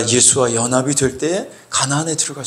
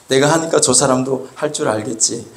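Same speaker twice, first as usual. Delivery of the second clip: ordinary delivery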